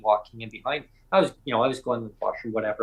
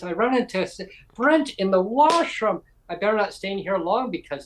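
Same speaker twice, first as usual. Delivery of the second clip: higher-pitched, joking dismay in a retold quote; mock alarm; 6 semitones higher than usual. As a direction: play up the scandal, pitched up. Premier jokes about the encounter.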